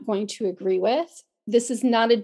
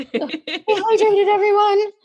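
high, slow sing-song call of Stay hydrated; sing-song announcer; 10 semitones higher, 6 dB louder, slower than usual. Acted. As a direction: draw it out like a cheerful announcer. Playful reminder to listeners.

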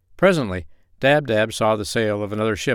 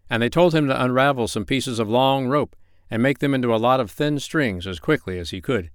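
ordinary delivery

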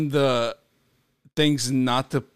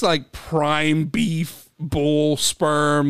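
slow disbelief; much slower than usual. Real, not acted. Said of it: "slow incredulous stress".

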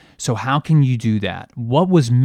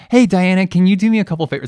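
much higher and louder, fast sweet ask; sing-song high request to the assistant; voice goes way up; 7 semitones higher, 8 dB louder, much faster than usual. Shown as a demonstration. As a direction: push the voice up high to ask the favor. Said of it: "my voice goes up a couple, like, octaves here".

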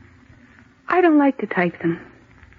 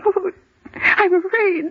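tearful fear; 8 semitones higher, slower than usual. Acted. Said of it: "what is there to cry about".

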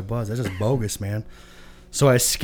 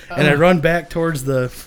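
usual speaking voice

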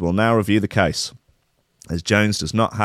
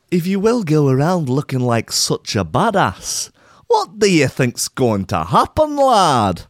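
slow, higher mock Scottish telling-off; Scottish accent; 6 semitones higher, slower than usual. Host scolding the gun owner in a mock Scottish accent. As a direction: wag a finger at the lad in a put-on Scottish accent. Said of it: "What on earth is this accent? Oh, is it Scottish now?".

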